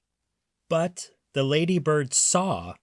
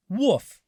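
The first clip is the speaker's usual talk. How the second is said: high, louder bark; dog woof; 8 semitones higher, 3 dB louder than usual. A narrator's reading. Reading to children, a high dog woof. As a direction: yap like a small dog. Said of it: "said the dog".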